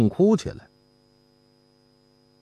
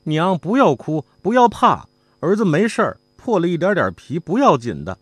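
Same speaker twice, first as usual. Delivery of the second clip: son pleading; 9 semitones higher, 4 dB louder than usual. A narrator's reading. Son comforts his wailing mother.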